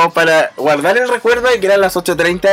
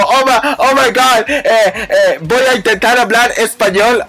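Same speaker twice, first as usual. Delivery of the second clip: higher, halting mock-flustered voice; imitated nervous fluster; 6 semitones higher, slower than usual. Nervous Japanese man attempting Spanish, imitated fluster. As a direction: stammer like a nervous, overwhelmed fan. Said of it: "se puso como nervioso".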